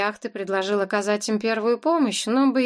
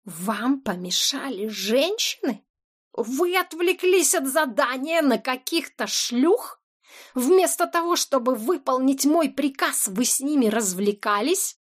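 outraged; 5 semitones higher than usual. A narrator's reading. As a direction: build from disbelief to fury. Boss outraged at guards.

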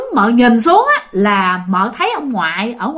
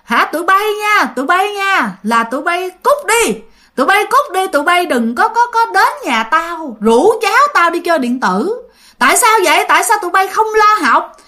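grandfather scolding; 8 semitones higher than usual. Retold or imitated. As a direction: bark at the kids to get out and go study. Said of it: "mợ nghe ông ngoại chửi vậy nè".

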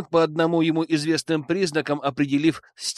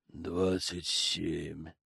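emotionless; 10 semitones lower, 11 dB quieter, much slower than usual. Acted. Hypnotized Sam answers flatly.

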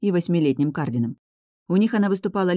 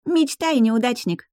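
high-pitched little-sister jeer; mocking, high child voice; blurted taunt; 8 semitones higher, slower than usual. A narrator's reading. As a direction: fire back a childish put-down. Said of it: "брякнула в ответ".

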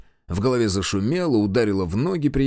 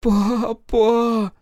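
high tearful wail for papa; wailing bride; 12 semitones higher, 4 dB louder than usual. Acted. Bride wailing to her father in tears.

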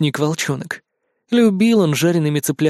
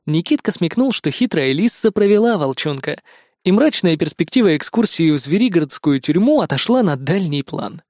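usual speaking voice

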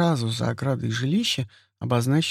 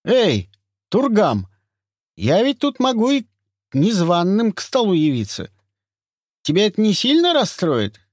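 bear voice, higher; 8 semitones higher, 5 dB louder than usual. Acted. Tich teasing Turgam.

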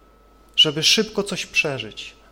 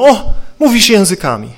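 loud, exclaiming mock delight; mock amazement; 3 semitones higher, 12 dB louder than usual. Preacher voicing instructors who hear new syllables, loud mock amazement. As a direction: imitate onlookers thrilled at a sudden breakthrough. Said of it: "I wtedy mówią".

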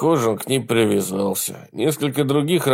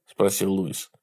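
plain reading voice

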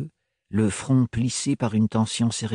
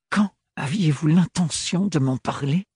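voiced higher, edged young woman's reproach; Ophélie, tense young woman; 6 semitones higher than usual. A narrator's reading. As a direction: lift the voice for a proud, hurt young woman. Ophélie confronts Thorne. (jeune femme blessée et fière, voix plus aiguë)

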